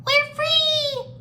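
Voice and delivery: very high, loud little squeal; squeaky gecko. A narrator's reading. Small gecko escaping the cage, joyful squeak. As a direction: squeak it out like a tiny freed creature. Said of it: "a small gecko squeaked".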